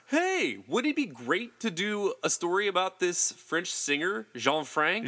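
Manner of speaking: high, drawn-out imitation of an excited girl; mock eager pitch. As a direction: pitch it slowly in a high, hammed-up girly eagerness. Acts out girl's eager pitch.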